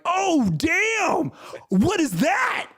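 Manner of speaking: very high squeaky fake shock; mock surprise. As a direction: gasp it like a bad actor. Acting fake surprise on a date.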